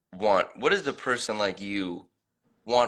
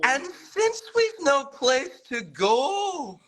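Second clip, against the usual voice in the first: much higher, slow Scottish imitation; Scottish accent; slower than usual. Shown as a demonstration. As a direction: strain for the Scottish lilt. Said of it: "in a Scottish accent".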